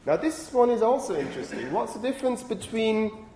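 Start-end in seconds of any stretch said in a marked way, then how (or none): none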